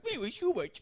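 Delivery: high, strained Hubie Halloween voice; a goofy high Sandler impression; Adam Sandler voice. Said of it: "That's literally how he talked".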